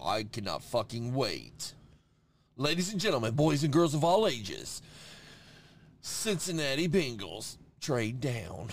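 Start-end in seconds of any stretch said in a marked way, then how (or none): 2.6-8.7 play-acted big draft announcement; mock announcer. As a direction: proclaim it like a hammed-up emcee. Mock sports announcer proclamation.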